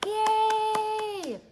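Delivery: high loud cheer; excited cheer. Acted. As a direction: cheer with joy. Cheer after song.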